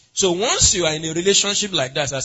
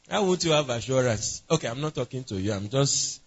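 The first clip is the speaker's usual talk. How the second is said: ordinary talk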